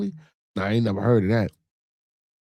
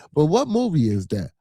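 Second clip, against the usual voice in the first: ordinary talk, nothing marked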